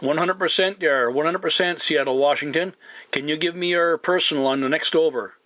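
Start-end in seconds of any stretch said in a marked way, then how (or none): none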